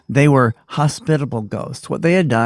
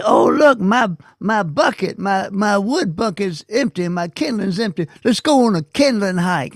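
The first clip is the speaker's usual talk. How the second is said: high, sing-song aunt voice; playful aunt; 7 semitones higher than usual. Retold or imitated. Aunt to little kids about an empty wood bucket, playfully high. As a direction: act out an aunt rallying the little ones. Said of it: "she would say".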